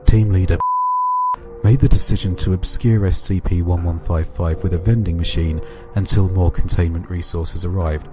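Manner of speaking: narrated report reading; steady documentary report voice. Acted. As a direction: read it like a case file. Reads SCP containment report.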